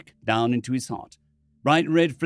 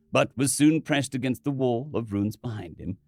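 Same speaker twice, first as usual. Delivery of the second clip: ordinary delivery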